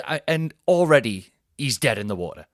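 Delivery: usual speaking voice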